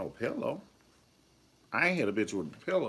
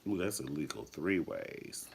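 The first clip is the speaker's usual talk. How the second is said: ordinary talk, nothing marked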